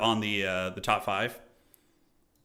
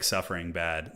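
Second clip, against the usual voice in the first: normal talking voice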